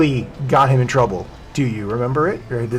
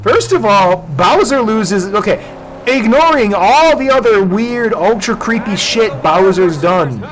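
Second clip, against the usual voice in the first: raised excited rant; loud, high heated rant; 7 semitones higher, 6 dB louder than usual. Real, not acted. Ranting loudly about Bowser.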